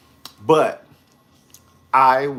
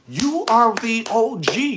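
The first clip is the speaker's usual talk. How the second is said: hyped exclamation; 11 semitones higher, 6 dB louder than usual. Real, not acted. Hyping Sheree up.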